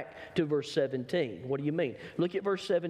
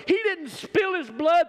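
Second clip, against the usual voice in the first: high, loud shouted line; preacher's shout; 12 semitones higher, 6 dB louder than usual. Acted. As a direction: cry it out at the peak. Preaching climax.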